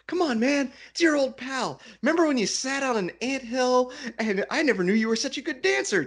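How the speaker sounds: high, animated coaxing; jolly old pal. Acted. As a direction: egg on a buddy with teasing reminiscence. Ned cajoling his old pal.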